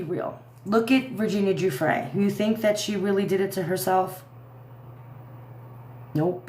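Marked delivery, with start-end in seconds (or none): none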